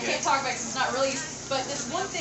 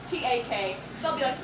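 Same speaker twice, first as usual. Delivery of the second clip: usual speaking voice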